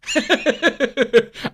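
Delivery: winded burst of laughter; breathless laugh. Real, not acted. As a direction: laugh it off while catching your breath. Laughing at his own excitement.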